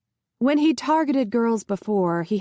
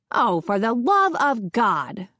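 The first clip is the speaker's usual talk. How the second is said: mild shriek; slower than usual. A narrator's reading. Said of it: "Pinky Parsons shrieked".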